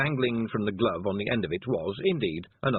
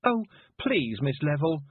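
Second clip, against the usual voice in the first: wailed; 6 semitones higher, slower than usual. Acted. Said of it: "Tiffany wailed".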